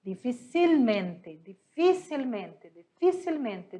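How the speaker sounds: "dificilmente" said slowly, stress on fi; slow repeated demo. Shown as a demonstration. Teacher drilling "dificilmente" for the class. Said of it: "Por quê?".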